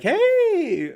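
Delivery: squeaky high greeting to show the range. Shown as a demonstration. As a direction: pop way up into your high voice. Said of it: "having a little bit of a higher voice".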